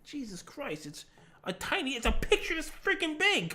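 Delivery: high-pitched, hushed mock outrage; retold incredulous protest. Retold reaction to emote prices.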